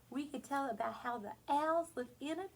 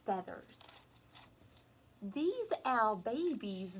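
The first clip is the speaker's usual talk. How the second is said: normal talking voice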